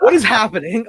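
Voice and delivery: high, loud baffled outburst; retold bewilderment. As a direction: replay your own shocked confusion. Retells being blindsided by the clip.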